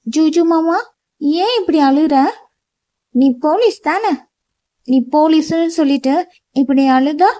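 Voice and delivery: a small girl mocking her crybaby uncle; teasing little girl. A narrator's reading. Girl teases crying uncle. (உயர்ந்த குரலில் கேலி செய்யும் சிறுமி)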